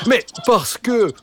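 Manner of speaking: lightly voiced dog explaining; talking dog. A narrator's reading. Dog begins explaining to the boy, lightly voiced.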